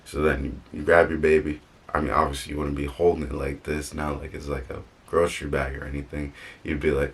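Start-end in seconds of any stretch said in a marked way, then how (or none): none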